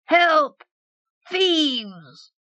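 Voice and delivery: put-on high-pitched drunken cry for help; screaming for help. A narrator's reading. Struggling patron hauled out by tapsters, screaming high for help. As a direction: cry out high, drawing the words out. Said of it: "he screamed".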